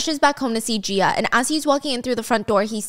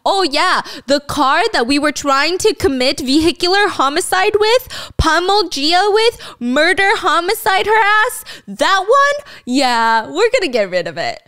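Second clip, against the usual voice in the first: lightly voiced careless plotter, pitched up; breezy mock quote; 6 semitones higher, 4 dB louder, slower than usual. Plotters casually discuss the murder car, mock breezy tone. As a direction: toss off murder talk like small talk. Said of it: "It's just like".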